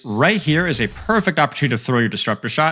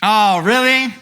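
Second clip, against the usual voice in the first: much higher, louder hammed-up indignation with a laugh; mock outrage; 9 semitones higher, 3 dB louder, slower than usual. Streamer mock-yelling at chat for ruining his storyline, hammed-up outrage. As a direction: yell in fake indignation, grinning.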